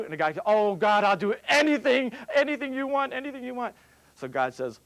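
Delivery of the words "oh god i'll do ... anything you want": desperate begging. Acted. Man begs God to save him.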